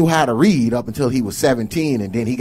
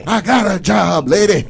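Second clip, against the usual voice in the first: playful shout; 7 semitones higher, 8 dB louder than usual. Pretend. Jokingly voicing the son.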